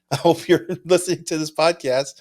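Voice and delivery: laughing. Real, not acted. Laughing while teasing bidder.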